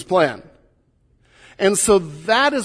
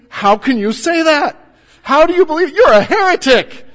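mock outrage; 9 semitones higher, 8 dB louder than usual. Imagined outrage at a professor's commentary.

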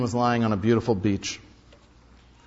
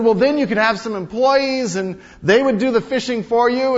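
higher, louder eager salesman; businessman pitch; 10 semitones higher, 7 dB louder than usual. Retold or imitated. Businessman urging the fisherman to work harder, eager. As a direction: sell him the big plan. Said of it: "He said".

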